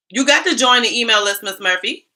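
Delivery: loud teasing call to a viewer. Real, not acted. Calling out a chat viewer, loud and teasing. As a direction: call her out loud, playful.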